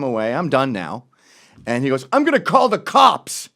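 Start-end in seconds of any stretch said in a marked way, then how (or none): 2.1-3.5 loud, higher whiny threat; mock the other guy's threat. Beaten dog owner threatening to call police, mock whine.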